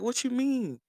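high-pitched mock confusion; puzzled friend. Imitating confused friend on phone.

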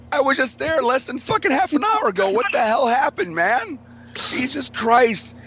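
high-pitched exclaimed disbelief; incredulous. Retold or imitated. Telling a friend he only just left, high and incredulous. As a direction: act out being stunned you missed it. Acts out his astonished reply.

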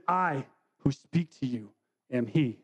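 slow it down and let each word sink in; solemn and slow. Acted. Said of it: "I think that that's very significant".

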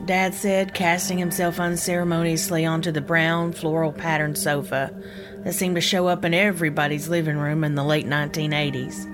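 ordinary delivery

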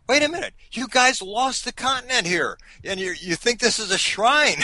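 high-pitched mock disbelief, easing down; retold incredulous protest; incredulous challenge. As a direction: call them out, voice jumping up in disbelief. Retells own challenge to the British.

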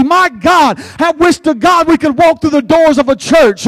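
usual speaking voice

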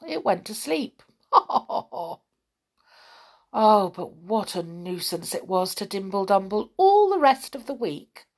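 ordinary delivery

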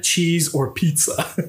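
slow, odd affected read-aloud voice; put-on reading voice. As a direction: read it like a hammy narrator. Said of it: "Why are you talking like that?".

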